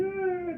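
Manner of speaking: high-pitched imitated kids' wail; mock crying. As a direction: wail like crying children, put on. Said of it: "they're crying".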